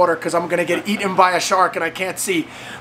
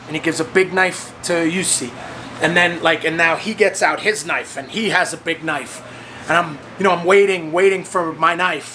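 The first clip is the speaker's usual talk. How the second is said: normal talking voice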